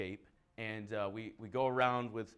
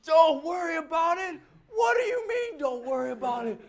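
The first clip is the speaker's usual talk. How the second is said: furious customer; 13 semitones higher, 10 dB louder than usual. Retold or imitated. Angry customer shouting at the worker: hammed-up fury. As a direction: rage at the worker in a put-on voice. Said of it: "that guy was not happy".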